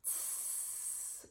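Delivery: "Tss" whispered, stop then hiss; voiceless t into a long s; demo t-s sound. Shown as a demonstration. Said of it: "there's a stop in the airflow".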